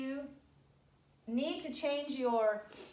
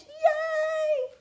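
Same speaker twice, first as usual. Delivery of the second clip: cheer; 9 dB louder than usual. Real, not acted. Last word of school year.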